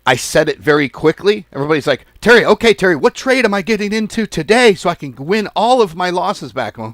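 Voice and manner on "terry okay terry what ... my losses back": loud, high, rushed-sounding pestering; mock-frantic plea for a tip; mock eager follower. Mimicking followers begging for trades.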